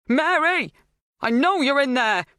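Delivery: loud, high shout through the door. A narrator's reading. Helen pounding the door, shouting at Mary. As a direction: yell at the door while pounding.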